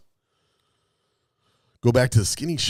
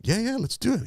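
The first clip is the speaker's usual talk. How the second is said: much higher, eager agreement; eager naive yes; 12 semitones higher than usual. Retold or imitated. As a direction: jump at the chance. Eager self quote.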